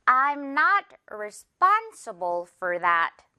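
I'm not responsible for that said very slowly, NOT and SPON stressed; very slow teacher model of the sentence; slow stress drill. Shown as a demonstration. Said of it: "바로 갈게요".

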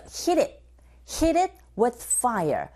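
slow drill of hit it with a flapped T. Shown as a demonstration. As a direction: flap the T in hit it, slowly. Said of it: "르에 가깝게 소리가 들리게".

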